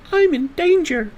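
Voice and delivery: very high, slow, squeaky meme voice; mock panic. Joking to Bob the tactician, a squeaky high mock-scared voice.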